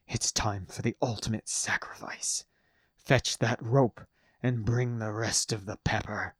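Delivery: steel yourself for sacrifice; grim courage. A narrator's reading. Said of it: "glazed with grim courage".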